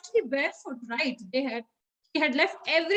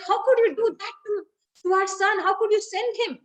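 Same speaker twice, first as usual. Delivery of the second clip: higher, louder storytelling reproach; hammed-up indignant questioning; angry mother, acted; 6 semitones higher, 4 dB louder than usual. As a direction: act out a distraught wife accusing her husband. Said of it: "she's so angry".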